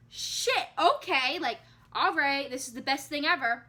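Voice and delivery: high, loud exclamation settling down; amazed relief. Retold or imitated. Learning she needs no stitches, amazed relief. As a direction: exclaim it in happy retold astonishment.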